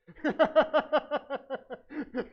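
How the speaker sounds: loud high cackling laugh; real amused laughter; high burst of laughter. Real, not acted. Laughs at own anecdote.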